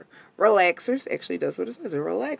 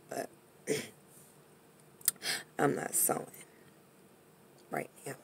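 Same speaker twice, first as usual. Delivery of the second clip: muttered aside; 6 semitones lower, 13 dB quieter than usual. Real, not acted.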